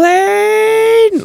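very high, loud, drawn-out mock yell; Sam Jackson yelling. Host imitates the buddy cop calling his partner, high mock yell. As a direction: holler the name out long, imitating an exasperated movie partner. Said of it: "yelling out".

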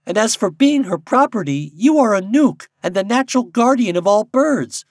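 high, loud bird making a case; grave robin. A narrator's reading. Robin arguing with Popopo for freedom, gravely. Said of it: "said a robin redbreast gravely".

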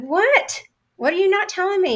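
sharply high, insistent questions; high indignant demand. Retold or imitated. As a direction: push him to spit it out. Pressing boss for the truth.

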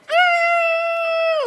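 high shrieking yell of rage; kid's furious shriek; angry yell. Retold or imitated. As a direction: let out a furious high scream. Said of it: "like this".

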